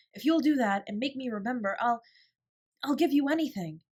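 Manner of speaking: high, loud and eager; hopeful plea. A narrator's reading. Ermengarde pleading with Sarah, hopeful and eager. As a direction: beg with sudden hope. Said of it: "hope gleaming in her round face".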